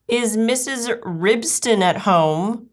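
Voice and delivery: high, slow, genteel enquiry; drawn-out, formal visitor's question; polite calling out. Acted. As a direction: call sweetly at a friend's door. Duchess calls politely at door.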